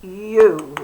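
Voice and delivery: loud long U as in uniform; a drawn long U sound. Shown as a demonstration. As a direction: call out the long U. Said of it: "And then uniform".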